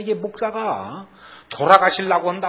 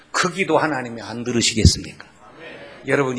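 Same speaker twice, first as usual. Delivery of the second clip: ordinary talk, nothing marked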